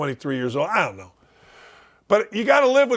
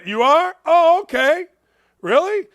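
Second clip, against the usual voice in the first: high, questioning mock surprise; mock amazement; 9 semitones higher, 4 dB louder than usual. Host answering a boasting athlete, mock amazement. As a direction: feign being impressed, pitched way up.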